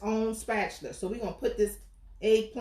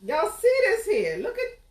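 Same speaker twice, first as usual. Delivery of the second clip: much higher, louder, stretched out; excited; 11 semitones higher, 5 dB louder, slower than usual. Real, not acted. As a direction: squeal it out, thrilled. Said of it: "I'm excited".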